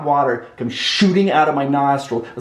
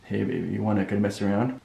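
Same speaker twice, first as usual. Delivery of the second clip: low, hushed, sly invitation; soft come-on; 6 semitones lower, 8 dB quieter, faster than usual. Retold or imitated. Husband quietly inviting his wife to mess around, low and hushed. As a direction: murmur it like a seduction line. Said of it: "it goes something like this".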